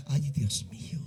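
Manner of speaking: breathy whispered Ay, Dios mío; a hushed, voiceless lament; whispered sigh. Real, not acted. Sighed aside in the sermon.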